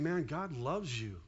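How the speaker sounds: soft and slow, gently; a quiet slow warm aside; tender reassurance. Retold or imitated. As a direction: tell them God loves them, tenderly. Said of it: "Instead of saying".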